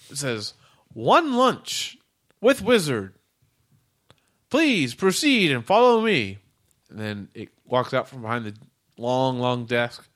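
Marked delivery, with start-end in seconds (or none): 0.9-6.4 high slow robot voice; clipped machine speech, pitched up. Acted. Robot speaks.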